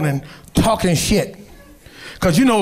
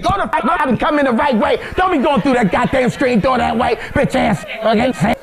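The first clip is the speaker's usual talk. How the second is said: grandma scolding; 3 semitones higher than usual. Retold or imitated. Grandma scolding through trach voice.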